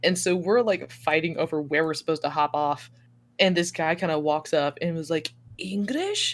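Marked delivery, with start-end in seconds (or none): 5.6-6.3 lightly voiced stranger asking, higher pitch; higher, questioning mimicry; imitated stranger. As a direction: imitate a man checking your language. Imitates man approaching them abroad.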